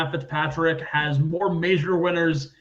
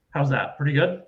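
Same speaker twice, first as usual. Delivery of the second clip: ordinary talk, nothing marked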